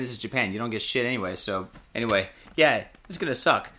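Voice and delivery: ordinary talk, nothing marked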